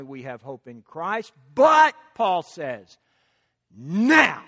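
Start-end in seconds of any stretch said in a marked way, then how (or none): 3.7-4.4 NOW punched loud with a sweeping rise and fall; emphatic NOW. Acted. Preacher quoting Paul to the congregation, NOW emphatic. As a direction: hit NOW hard and stretch it. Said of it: "you hear the emphasis?".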